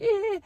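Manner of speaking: a short squeal standing in for the trampoline ripping; imitated fabric rip. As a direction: mimic the sound of a shirt tearing. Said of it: "I heard a horrible ripping noise".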